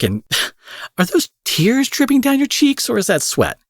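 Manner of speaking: high, giggling mockery; laughing taunt. Villain taunts crying hero.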